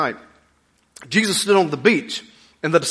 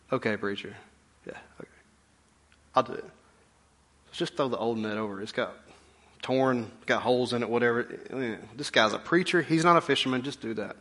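grudging mutter; 5 semitones lower, 10 dB quieter than usual. Retold or imitated. Grudging fishermen humoring Jesus.

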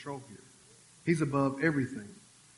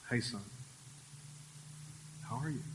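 soft, lower, tender greeting; gentle God voice; 5 semitones lower, 13 dB quieter than usual. Retold or imitated. Preacher voicing God's quiet greeting, gentle and soft. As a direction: speak softly like a kind father. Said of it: "he talks to you".

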